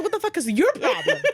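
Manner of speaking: mocking girlfriend echo. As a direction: throw his words back at him, high and amused. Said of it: "She's like".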